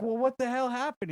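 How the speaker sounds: exasperated demand. Retold or imitated. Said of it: "he's like".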